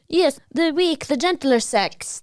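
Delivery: put-on slow old-time radio announcer; lightly voiced vintage announcer; old-timey radio voice. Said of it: "that's like a old-timey radio voice".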